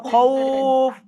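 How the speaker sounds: খৌফ with the ঔ held for one alif, slowly; stretched vowel. Shown as a demonstration. Teacher demonstrating recitation to the class, drawing out খৌফ. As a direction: draw out the middle of খৌফ for about a second. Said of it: "এই জন্য আমরা টান দিচ্ছি".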